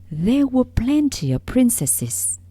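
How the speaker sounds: ordinary delivery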